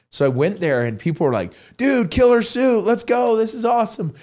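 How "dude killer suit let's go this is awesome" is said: hyped fan. Retold or imitated. Fans cheering at football game.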